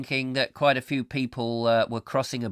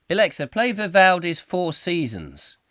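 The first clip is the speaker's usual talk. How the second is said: higher, louder imitated command; 7 semitones higher, 5 dB louder than usual. User asks Alexa for classical music, as a raised command. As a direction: address the machine up and loud. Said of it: "If you say to it".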